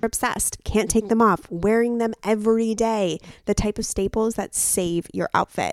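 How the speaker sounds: upbeat sponsor read. Acted. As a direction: sell it like a radio spot.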